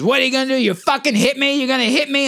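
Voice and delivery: high taunting stranger, daring a hit; crazy lady taunting. Retold or imitated. Man imitating a woman daring him to hit her, pitched high. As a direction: goad him like a wild woman. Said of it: "She goes".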